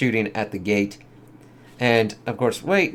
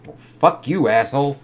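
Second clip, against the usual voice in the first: higher, louder cussing at the villain; mock angry retort; 5 semitones higher, 5 dB louder than usual. Real, not acted. Viewer cussing out Shockwave, louder mock anger. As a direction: snap back at the screen. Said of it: "It's like".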